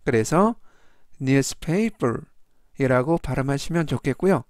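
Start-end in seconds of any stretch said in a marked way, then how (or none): 1.2-2.2 "newspaper" slow, with the stress on the first syllable; slow down and lean on the start of "newspaper"; stress on the first part. Shown as a demonstration. Teacher repeats "newspaper" slowly, stressing the first syllable. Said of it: "앞부분에 있는 이에 강세가 있습니다".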